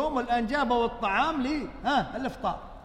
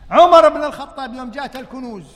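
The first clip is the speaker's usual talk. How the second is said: louder, rising preacher opening; forceful preaching; 4 semitones higher, 10 dB louder than usual. Real, not acted. Preacher opening the treasure story to listeners, loud.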